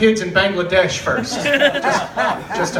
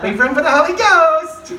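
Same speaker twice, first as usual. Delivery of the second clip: higher and louder, scolding; mock teacher's aide; 9 semitones higher, 6 dB louder than usual. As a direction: wag the finger harder on the repeat. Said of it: "she's like".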